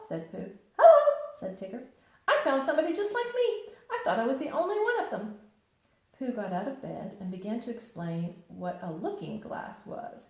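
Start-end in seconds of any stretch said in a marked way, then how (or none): 0.8-5.4 very high, loud bouncy hello; squeaky bright Tigger greeting. Acted. Tigger answering Pooh's hello outside the door.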